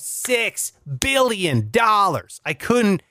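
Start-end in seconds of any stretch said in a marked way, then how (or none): none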